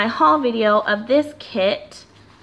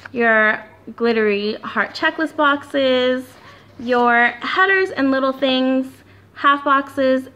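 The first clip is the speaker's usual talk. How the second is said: ordinary talk, nothing marked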